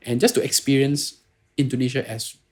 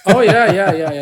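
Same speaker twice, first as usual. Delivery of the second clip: high, loud burst of yeah yeah; sudden loud eager yeahs; excited agreement; 8 semitones higher, 13 dB louder than usual. Real, not acted. Excited agreement on Bapa/Ibu.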